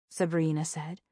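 ordinary delivery, nothing marked

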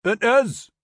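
high, loud broad Geordie; testy Newcastle accent. A narrator's reading. Ferry soldier answering the major, testy broad Newcastle accent. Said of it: "replied the man testily in a broad Newcastle accent".

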